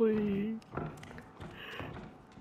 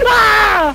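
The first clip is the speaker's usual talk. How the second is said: long, loud scream falling in pitch; real jump-scare yell; frightened scream; 2 semitones higher, 7 dB louder than usual. Real, not acted. Player caught after someone made noise, frightened scream.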